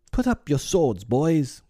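high, ringing order to comrades; high heroic command; commanding cry. A narrator's reading. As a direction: call the boys off in a high voice. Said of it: "cried the newcomer".

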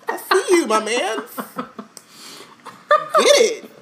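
high playful hype; cheering. Real, not acted. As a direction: egg him on from the window. Cheering on a stranger.

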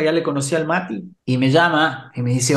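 usual speaking voice

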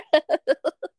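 a high burst of real laughter; sudden high laugh at the joke. Real, not acted. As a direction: laugh out loud, high and bright.

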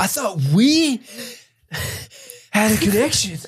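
milk the heartbreak hammily; wounded lover. Pretend. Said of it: "Jack's like".

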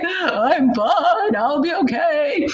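high, loud, sobbing through the words; hammed-up tearful "I'm fine"; imitating own crying. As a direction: fake a brave face while bawling. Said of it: "that's my imitation of me crying".

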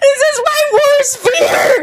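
high, drawn-out laughing wail; real flustered half-crying laugh; panicked wail. Real, not acted. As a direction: squeal it in comic panic. Panicking at being put on spot.

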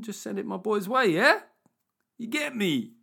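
high, rising street-slang patter; mock street slang with a high "yeah?"; put-on street slang. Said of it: "I don't get to speak like that".

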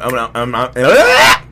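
loud rising garbled yell; mock outburst. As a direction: stammer up into a wordless yell.

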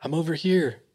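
mild, high call-out. Retold or imitated. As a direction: imitate a woman calling from afar, without shouting. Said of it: "they hear her call out".

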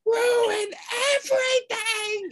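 put-on high, drawn-out child accusation; accusing little girl. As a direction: stretch it out like a wronged kid.

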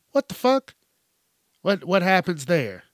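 high, loud what the fuck; outraged shriek of disbelief. Real, not acted. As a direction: burst out in disbelief. Outraged at dog biting kid.

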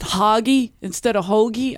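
hoggy with "ah", against hoagie with long "oh"; hoggy as HAH-gee, then hoagie said normally; mocking mispronunciation. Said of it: "What, what are you gonna say next?".

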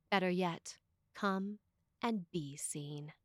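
ordinary delivery

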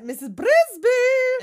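very high, loud, drawn-out mock call; hollering. Auntie Shrew arrives looking for Missus Brisby, hollering. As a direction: holler the name, stretching the end.

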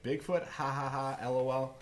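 spoken fake "ha ha" in mockery; mocking skeptics. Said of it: "these are people that they're like".

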